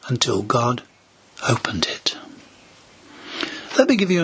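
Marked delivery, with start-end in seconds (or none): 1.5-2.2 hushed, breathless whisper. Acted. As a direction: drop to an awed whisper. Dramatic hushed sermon climax.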